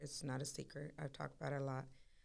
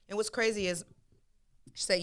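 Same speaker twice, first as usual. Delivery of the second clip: ordinary delivery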